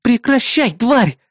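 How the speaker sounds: high, furious yell; roaring in rage. Acted. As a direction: roar at the beast. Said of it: "взревела Бэранговая".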